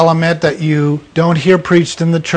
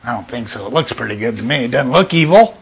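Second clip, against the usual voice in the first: lower, softer musing; Eve reasoning; 6 semitones lower, 5 dB quieter, faster than usual. Retold or imitated. Eve talking herself into eating, lowered musing. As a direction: talk herself into it under her breath. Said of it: "She's kinda going through".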